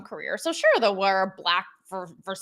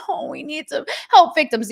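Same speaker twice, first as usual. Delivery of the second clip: mock fake crying; 5 semitones higher, 7 dB louder than usual. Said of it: "like, fake crying".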